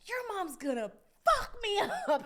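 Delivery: high, loud, laughing dread; big brother laughing in horror about mom; laughing panic. Retold or imitated. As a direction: cackle out the doom of it. Brother fears mom's reaction.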